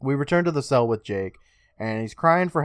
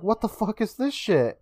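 high-pitched mock outcry; mock scream; 8 semitones higher than usual. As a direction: imitate a panicked kid without really yelling. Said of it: "And Jake's screaming".

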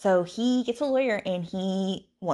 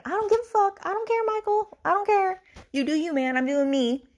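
very high-pitched, sassy dismissal; high, insistent imitation of exasperation; mock fed-up outburst; 4 dB louder than usual. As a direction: act out being totally done with someone, pitched way up. Imagined fed-up inner outburst, acted.

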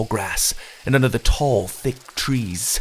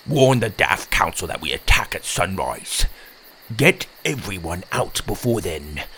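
low, croaky character voice; resigned swamp creature; 4 semitones lower than usual. Acted. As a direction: croak a grave warning to a friend.

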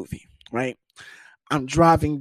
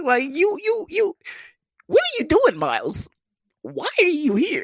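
much higher, incredulous woman's voice; mock exasperated woman; 13 semitones higher than usual. Woman asking why he showed up, high incredulous voice. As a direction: squeak out her disbelief.

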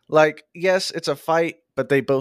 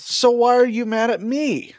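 a touch of an indignant Will, higher; lightly voiced Will, exasperated; 9 semitones higher than usual. Cohost reading Will demanding an answer, mildly indignant. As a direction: throw the question back, mildly exasperated.

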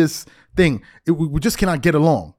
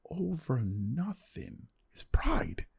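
whispered point; 12 dB quieter, much slower than usual. Real, not acted. Whispered point about pride.

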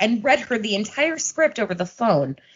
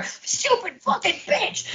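mock nasty mutter; 14 semitones higher, 4 dB quieter, slower than usual. Butch turns vicious in retold scene.

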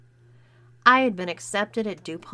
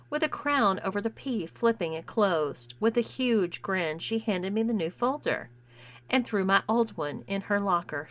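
plain reading voice

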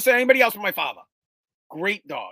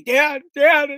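put-on high, loud, breathless little boy calling; panicked kid; 8 semitones higher, 10 dB louder than usual. Ten-year-old running home to tell dad, high loud panic. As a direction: cry out for your father like a scared ten-year-old. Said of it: "and I say".

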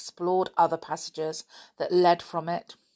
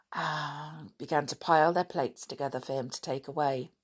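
ordinary delivery, nothing marked

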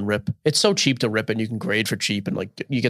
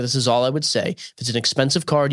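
normal talking voice

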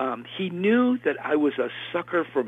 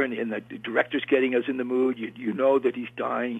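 usual speaking voice

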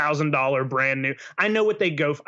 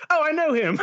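laughing; 12 semitones higher than usual. Retold or imitated. Imagined buyer's reply.

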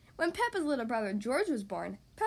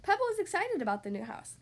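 usual speaking voice

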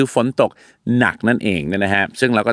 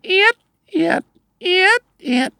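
high-pitched squeaks of a wiper; evenly spaced squeaky wiper noise; imitated wiper squeak. Shown as a demonstration. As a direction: mimic windscreen wipers at medium speed. Imitating wipers at medium level.

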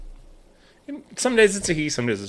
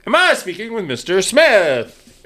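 imitating a chirpy caller, drawn out and higher; telemarketer; 5 semitones higher, slower than usual. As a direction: put on a slow, high-pitched sales-call greeting. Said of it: "when you answer one of these spam calls".

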